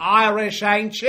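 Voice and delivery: lightly voiced English lady asking; mock English accent. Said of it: "And she asks".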